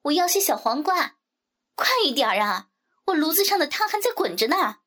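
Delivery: high, pushy customer calling out; brash neighbourhood gossip's big voice; loud-mouthed woman. A narrator's reading. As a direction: holler impatiently with swooping pitch. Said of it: "标准高八度的大嗓门".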